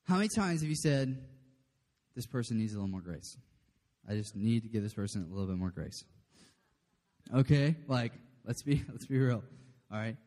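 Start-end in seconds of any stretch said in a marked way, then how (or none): 2.1-6.0 lower, softer mock-concerned aside; low muttered judgment. Churchgoer remarking on a person needing grace, low and muted. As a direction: say it low, under your breath.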